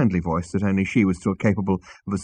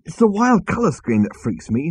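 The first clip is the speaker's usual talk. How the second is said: exasperated; 7 semitones higher than usual. Acted. Zaphod rants about the ship.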